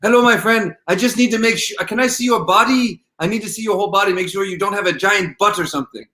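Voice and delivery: high-pitched, badly faked French accent; hammed-up French accent, pitched well up; horrible French accent. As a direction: imitate a Frenchman with a terrible accent. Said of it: "I'm gonna have a horrible, horrible French, uh, accent".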